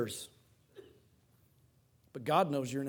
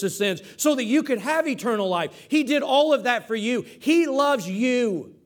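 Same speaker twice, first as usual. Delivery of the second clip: louder, higher sermon drive; preaching at full force; 4 semitones higher, 5 dB louder than usual. Acted. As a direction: press the point home to the congregation. Fervent preaching of God's love.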